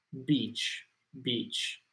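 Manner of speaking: "Beach" with a long ee, said softly; long vowel. Shown as a demonstration. Teacher reassuring student about saying playa, soft long-ee "beach". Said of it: "la otra palabra es más corta".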